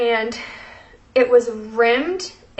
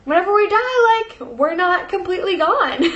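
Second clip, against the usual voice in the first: emotional; 6 semitones higher than usual. Real, not acted. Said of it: "I'm sorry for getting emotional".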